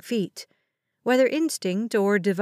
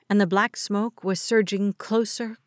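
plain reading voice

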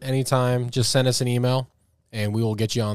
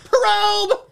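high squeaky alien shriek; 5 dB louder than usual. Pretend. Playing alien probe.

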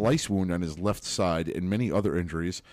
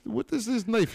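high mock-Russian squeal; high squeaky voice; 14 semitones higher than usual.

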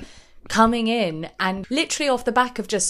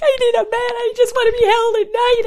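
much higher, louder needy wail; Ramona impression; 7 dB louder than usual. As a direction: whine it out like a desperate diva. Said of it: "Ramona's whole thing of being like".

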